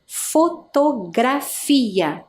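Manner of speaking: "fotografia" stretched slowly, syllable by syllable; drawn-out syllables. Shown as a demonstration. Teacher slows the word so pupils hear its syllables, drawn-out. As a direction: draw out each piece of "fotografia". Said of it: "Eu até demorei para fazer a pronúncia".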